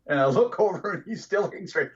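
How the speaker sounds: ordinary talk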